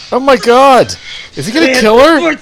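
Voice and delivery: loud, high, alarmed gasp of shock; shocked outcry. Real, not acted. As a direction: cry out at a sudden twist. Shocked reaction to violent scene.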